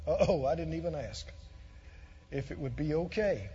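low, soft anxious murmur; a hushed uh-oh of worry; worried inner voice. Retold or imitated. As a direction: voice her nervous second thoughts quietly. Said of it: "because she thought".

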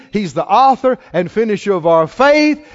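ordinary delivery

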